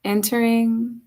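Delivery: slow, unhurried yoga nidra guiding voice with long pauses; measured, spacious meditation delivery; guided meditation. Acted. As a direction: guide them gently into rest, leaving space between phrases.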